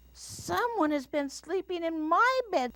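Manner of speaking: very high little bear; baby bear voice. A narrator's reading. Little Bear finds his bed slept in, high baby voice. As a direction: squeak it out like an upset cub. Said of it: "in his baby bear voice".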